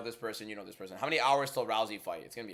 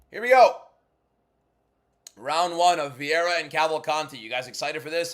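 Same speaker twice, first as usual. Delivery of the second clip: hype announcer; 5 semitones higher, 9 dB louder than usual. Pretend. Streamer announcing round one to viewers, loud hype call. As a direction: hype the crowd with big projection.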